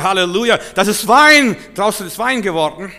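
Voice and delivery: high, loud rejoicing; jubilant cheer. Retold or imitated. Preacher acts out believers cheering over the miracle, loud and high. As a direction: shout it out in triumph.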